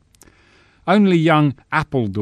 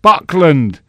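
loud, higher shouted answer; dutiful cry; 7 semitones higher, 11 dB louder than usual. Acted. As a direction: call it out on cue. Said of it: "came the dutiful cry".

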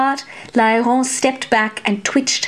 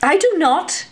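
much higher, urgent protest; 8 semitones higher than usual. A narrator's reading. Nun protests the deal, high urgent refusal. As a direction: refuse flatly, alarmed. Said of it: "Lairon protested".

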